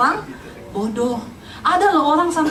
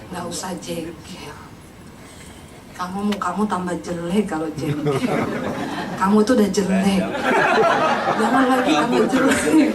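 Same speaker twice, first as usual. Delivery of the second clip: inner voice; 6 semitones lower than usual. Acted. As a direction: gently chide from inside someone's head. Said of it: "tiba-tiba ada suara yang ngomong gini".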